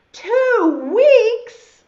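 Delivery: high, very loud disbelief; shocked outburst. Acted. Caterpillar realizes how long he must wait, shocked shout. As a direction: explode as the news sinks in.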